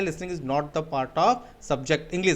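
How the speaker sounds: very slow, deliberate English line; measured slow moral of the lesson; slow summary. Real, not acted. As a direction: lay down the key point word by word. Teacher summarizing key point slowly.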